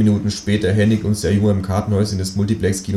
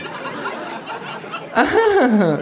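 high mocking kangaroo laugh imitating her. A narrator's reading. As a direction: echo the fake laugh. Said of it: "lacht auch das Känguru". (hohes, nachäffendes Känguru-Lachen)

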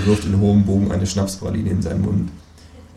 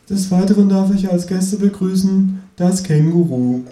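raised presenter voice; TV host voice; 7 semitones higher than usual. Acted. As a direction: welcome the guest like a host. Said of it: "fährt die Frau fort".

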